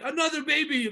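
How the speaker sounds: high, loud shocked cry; mock shock. Retelling the twin birth, high alarmed outburst. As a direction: blurt it out in alarmed surprise. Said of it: "she's like".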